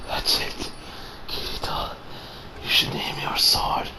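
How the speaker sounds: faint, breath-only acted last words; imitated fading voice; dying whisper. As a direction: whisper it weakly, with pauses.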